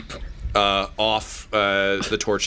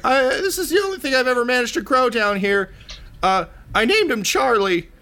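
much higher, eager little creature voice; high-voiced poop smith; 13 semitones higher, faster than usual. Poop smith handing over his treasured mushroom, high-voiced.

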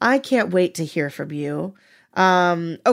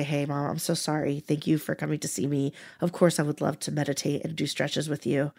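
soft, sincere apology, much quieter; 4 semitones lower, 8 dB quieter than usual. Retold or imitated. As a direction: gently make amends to your mother. Apologizing at mom's door.

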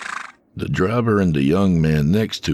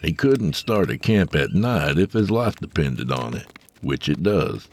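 plain reading voice

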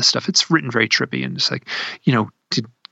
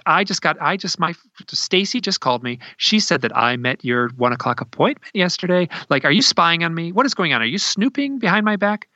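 retold accusing questions, higher pitched; mad; 5 semitones higher than usual. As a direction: imitate an indignant caller, pitch climbing. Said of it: "she's mad".

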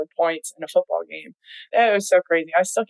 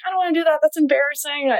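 put-on high, childish whine; whiny little girl; 9 semitones higher, faster than usual. Little girl telling mom, mock whiny and high. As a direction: sound like a reluctant kid. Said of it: "telling my mom, I was like".

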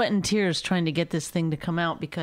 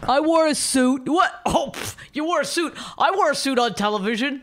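loud, high-pitched mock of a braggart; mocking Rich; 6 semitones higher, 3 dB louder than usual. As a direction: parody your partner's boasting, pitched way up. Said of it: "shitty impression of you".

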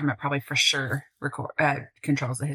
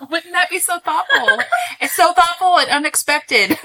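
high, laughing, teasing; 11 semitones higher, 7 dB louder than usual. Real, not acted. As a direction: giggle through the words. Teasing about birthday wishes.

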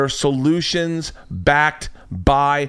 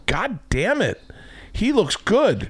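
higher, grudging admiration; impressed viewer; 9 semitones higher, slower than usual. Retold or imitated. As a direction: say it like you can't believe it. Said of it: "you're gonna say to yourself".

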